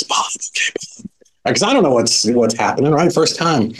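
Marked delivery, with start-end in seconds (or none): 0.0-1.5 hushed whisper while jotting a name; whispered note-taking. Retold or imitated. Newcomer noting the speaker's name, whispering to himself. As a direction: murmur the name as you write it down. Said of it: "So I'm like, taking notes".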